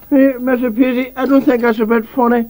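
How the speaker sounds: high, loud indignant complaint; loud protest. Acted. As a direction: protest at the top of your voice. Angry complaint at the host.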